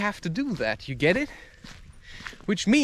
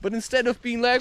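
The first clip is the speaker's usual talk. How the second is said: ordinary delivery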